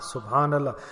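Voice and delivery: soft SubhanAllah. Shown as a demonstration. Preacher repeating it softly for the listeners to echo. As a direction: let SubhanAllah fade to a soft hush.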